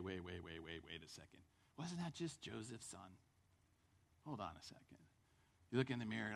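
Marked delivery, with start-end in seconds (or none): 0.0-5.0 much softer, lower second thoughts; doubtful murmur. Retold or imitated. Man doubting himself at home, soft and low. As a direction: mutter it to yourself in doubt.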